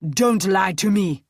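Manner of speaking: loud roar; furious roaring warning. Acted. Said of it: "Nick roared".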